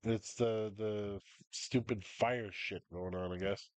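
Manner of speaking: ordinary talk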